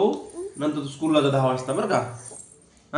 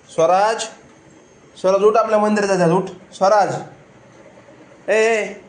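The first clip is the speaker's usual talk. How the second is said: raised, higher voice calling him awake; 7 semitones higher, 6 dB louder than usual. Real, not acted. Mother calls loudly to wake her sleeping son.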